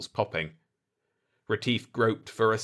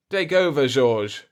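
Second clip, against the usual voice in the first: a little winded, higher and louder; panting; 4 semitones higher, 6 dB louder than usual. A narrator's reading. Retief, winded after the fight, hands over to Georges. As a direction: call it out between breaths. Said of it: "Retief said, panting".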